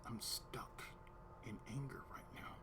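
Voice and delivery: low, barely audible whisper; whispered admission. Retold or imitated. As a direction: confess it under your breath. Retold answer to Damon.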